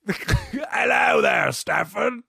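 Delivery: high, drawn-out British hello; mock British accent. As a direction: call out a hammed-up British greeting. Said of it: "Do a British version of you".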